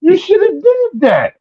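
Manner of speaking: high-pitched mimicked dad tirade; imitated high-voiced telling-off; scolding father. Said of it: "He said".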